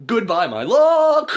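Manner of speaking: loud, high, drawn-out holler; imitated lovesick farewell. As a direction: wail a hammed-up farewell from the car. Driver calls farewell to his love.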